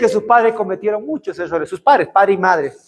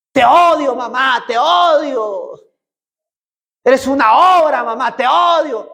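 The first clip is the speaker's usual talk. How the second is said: mock angry teen, higher and pushed; angry daughter; 7 semitones higher than usual. Preacher imitating a daughter shouting at her mother, angry. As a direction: hurl it at mom, drawn out.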